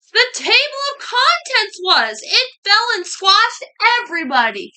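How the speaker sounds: high, loud punchline delivery; dramatic reveal. A narrator's reading. Narrator revealing what really fell, loud and dramatic. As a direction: belt out the story's climax. Climax of the story, loudly revealed.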